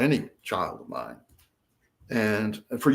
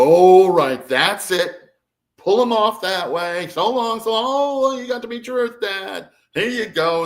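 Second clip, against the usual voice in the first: handlers pulling him away; 9 semitones higher, 7 dB louder than usual. Retold or imitated. Grey handlers drag him away.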